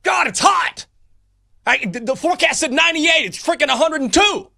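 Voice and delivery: high, loud Southern-free rant at the heat; hammed-up shout of a coach angry at the weather; mock yelling at the sun. Coach mock ranting at the sun, yelling loudly.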